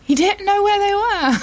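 high wailing mock sob; hammed-up crying over lost teeth. Mock lament about teeth.

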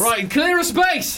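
high, slow announcement to a crowd; mock hyped-up shout. Metal fan clearing a mosh pit, mock shout. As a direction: call out in a high, hammed-up shout.

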